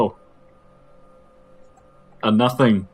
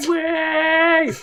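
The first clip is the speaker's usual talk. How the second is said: excited shout; 5 dB louder than usual. Pretend. Jingle shout.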